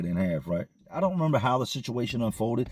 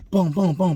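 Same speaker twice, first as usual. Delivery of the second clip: loud higher "boom, boom, boom" beats; banging sounds; 7 semitones higher, 5 dB louder than usual. Retold or imitated. Narrator imitating the officer banging on plexiglass, loud knocks.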